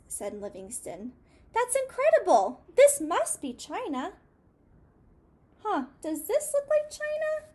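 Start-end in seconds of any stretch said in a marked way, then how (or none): 1.5-4.1 high, loud amazed squeak; a tiny mouse bowled over by wonder. A narrator's reading. Mouse thrilled at big building. 5.6-7.5 very high mock mouse voice asking the kids; playful, squeaky question to the children; teasing question. Shown as a demonstration. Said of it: "Maybe from his perspective it does".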